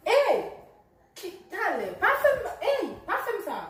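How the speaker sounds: loud, high, angry outburst; angry shout. Acted. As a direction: snap at him to stop. Angrily rebuffing the boyfriend.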